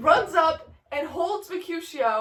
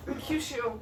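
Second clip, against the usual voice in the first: ordinary delivery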